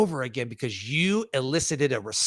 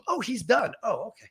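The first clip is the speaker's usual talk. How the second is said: gasp, then a higher, hushed realization; surprised listener; 6 semitones higher than usual. Retold or imitated. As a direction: caught off guard, imitating the audience. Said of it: "surprised that you're, like, finished".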